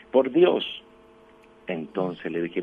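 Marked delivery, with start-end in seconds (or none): 0.1-0.6 loud, high-pitched mock child's answer; voz fuerte child. Child answers priest who asked why we come, in a strong voice. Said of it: "así con voz fuerte".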